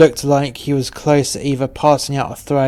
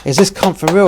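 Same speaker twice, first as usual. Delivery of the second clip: higher, louder disbelieving question; incredulous; 5 semitones higher, 5 dB louder than usual. A narrator's reading. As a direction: voice an exasperated thought in open disbelief. Narrator voices his disbelieving thought.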